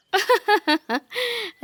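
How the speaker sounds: high, scornful burst of laughter; mocking laugh. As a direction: laugh at her to her face. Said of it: "నాకైతే నవ్వు వస్తుంది". (ఎగతాళి నవ్వు)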